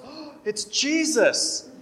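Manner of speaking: high, loud, drawn-out mock exclamation; awestruck child's shout. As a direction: gasp and cry out like an amazed kid. Imitates young cousin seeing priest arrive.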